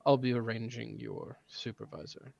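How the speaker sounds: measured, grudging parent; stern father. Acted. Father voice continues.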